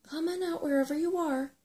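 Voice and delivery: loud, high-pitched summons; calling out. A narrator's reading. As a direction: call them out of hiding. Sorrel calls to hidden intruder.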